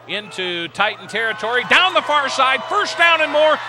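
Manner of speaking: play-by-play voice building to a yelling crescendo; building excited call. Real, not acted. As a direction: let the call climb to a roar as the runner breaks free. Long run called live.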